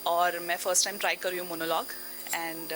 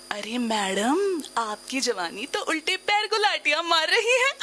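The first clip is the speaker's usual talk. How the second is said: flirty neighbour's husband; 8 semitones higher, 5 dB louder than usual. Acted. Neighbour's husband flatters madam's youth, laughing flirtation. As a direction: draw out the address, then break into a big laugh. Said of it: "पड़ोसन का पति बोले".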